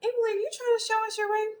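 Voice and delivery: high, fast singsong question; teasing friend. Acted. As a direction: put on a high voice, calling out the show-off. Acting friend noticing the ring.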